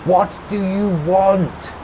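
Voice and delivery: high, slow, loud call. A narrator's reading. Rebecca to Tommy across traffic noise, calling out. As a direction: shout it slowly across a distance. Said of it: "Rebecca called out to him".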